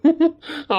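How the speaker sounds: high bright burst of laughter. Real, not acted. Husband laughing at the letter, high laugh.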